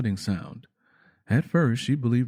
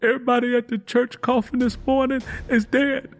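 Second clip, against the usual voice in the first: much higher, tearful; crying news; 12 semitones higher, 3 dB louder than usual. A narrator's reading. Tangy May crying that everyone is dead, high and tearful. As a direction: sob out the terrible news. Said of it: "continued to cry".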